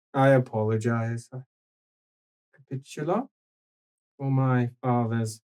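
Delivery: hint of a weary grown son; calm stern son. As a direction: scold the old man patiently. GM voices older son calmly.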